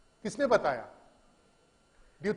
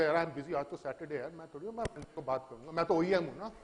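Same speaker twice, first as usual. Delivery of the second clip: dismissive vendor; 2 semitones lower, 7 dB quieter, much faster than usual. Acted. OEM fobbing off a partner who needs help, dismissive. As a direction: make a lame excuse, voice dropped.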